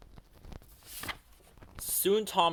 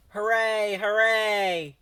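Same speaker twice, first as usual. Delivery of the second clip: loud, high cheer from the crowd; cheering shout; 7 semitones higher, 6 dB louder, slower than usual. A narrator's reading. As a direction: cheer as the train pulls in. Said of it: "the passengers shouted".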